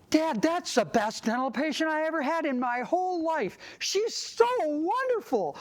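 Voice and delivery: high, over-the-top delight; gushing praise. Acted. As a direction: gush to a worried parent, stretching the high final praise. Acted praise of crying child to parent.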